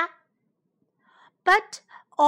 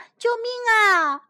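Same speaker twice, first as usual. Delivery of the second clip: shouting for help; 8 semitones higher, 5 dB louder than usual. A narrator's reading. Hedgehogs call for rescue from developers, shouting. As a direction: call urgently for rescue, voice lifted. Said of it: "刺猬们喊着".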